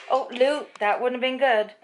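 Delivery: a quick jolt of alarm; startled. Real, not acted. Nearly tripped over the dog.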